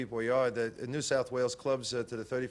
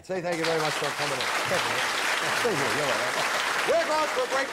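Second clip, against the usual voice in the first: loud excited sign-off to a break; big showman announcing the break; announcer; 3 semitones higher, 5 dB louder than usual. Acted. Host shouting into break.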